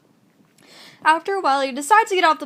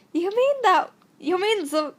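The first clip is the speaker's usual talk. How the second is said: character voice; 4 semitones higher than usual. Acted. Second character answers in story.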